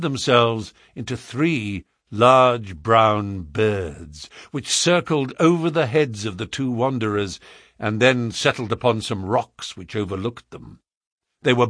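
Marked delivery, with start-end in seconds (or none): none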